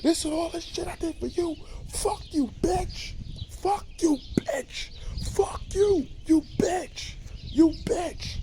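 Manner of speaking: high, shrieking curses hurled at his mother; retelling Franklin cursing out his mother, shrieking rage; furious screaming tirade. Retold or imitated. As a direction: scream it like he's lost his mind. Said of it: "Franklin goes in the, in the fucking tirade, going crazy".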